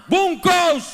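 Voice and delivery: loud, high angry command; hammed-up furious mom; mock angry order. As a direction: bark it like a fed-up mother. Mother snapping an angry order.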